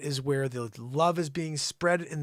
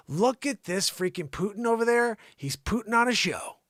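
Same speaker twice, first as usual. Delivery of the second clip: ordinary delivery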